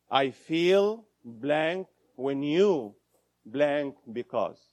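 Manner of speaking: slow, spelled-out template; slow formula. Shown as a demonstration. Teacher dictating the I-statement formula, slowly. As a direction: lay out each slot deliberately. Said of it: "there's a formula".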